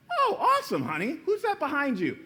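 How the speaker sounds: squeaky high "Oh, awesome" turning suspicious; playing the delighted, then puzzled wife; the wife greeting her husband, suddenly suspicious; mock wife. Acted wife's reply.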